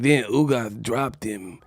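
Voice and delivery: slow, low, soft Tyson mumble; Mike Tyson impression. Comedian mocks Tyson's podcast claim, slow low mumble.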